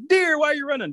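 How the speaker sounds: high-pitched question; calling out. Acted. Rabbit catches up with the deer, calling out. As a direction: Rabbit calling after a runner.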